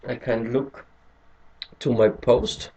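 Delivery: ordinary talk, nothing marked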